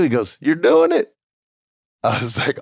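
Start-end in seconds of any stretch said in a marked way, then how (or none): 0.4-1.1 high, punched put-on voice; Bruce Pritchard. Mentor dumping the job on the newcomer, emphatic. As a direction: mentor impression, emphatic and pitched up. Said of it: "Bruce Pritchard comes back up".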